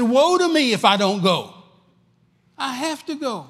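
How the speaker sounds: high, loud cry of woe; Paul's anguished cry. Acted. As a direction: cry it out high, torn but determined. Paul insists he must go.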